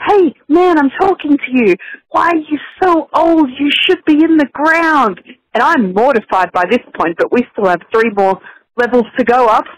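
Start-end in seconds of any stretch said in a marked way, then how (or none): 0.1-5.2 put-on higher, slower, blunt little kid voice; imitating her child. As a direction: mom doing her bold four-year-old. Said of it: "then she said".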